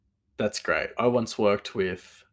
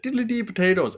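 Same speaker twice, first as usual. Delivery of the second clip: high-pitched stage-Irish catchphrase; mock Irish accent; 12 semitones higher, 4 dB louder than usual. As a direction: sing out a caricature Irish lilt, pitched high. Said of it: "whoever said".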